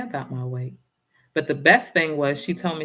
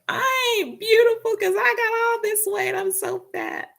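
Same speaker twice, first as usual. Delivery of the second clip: whiny self-pity. Retold or imitated. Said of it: "And I said".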